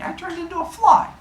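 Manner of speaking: squeaky high little fly voice, far above his own; tiny fly voice. Retold or imitated. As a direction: pitch way up like a tiny buzzing goddess. Goddess boasting in mock duel.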